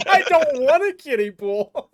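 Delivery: slow and laughing; laughing protest. Real, not acted. Laughing protest about the pool.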